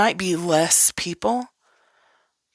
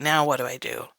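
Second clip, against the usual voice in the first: lower, softer self-talk; dawning realization; 6 semitones lower, 4 dB quieter, faster than usual. Retold or imitated. Person realizing their life is a mess, low dawning realization. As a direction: mutter it to yourself as it dawns. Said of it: "and going".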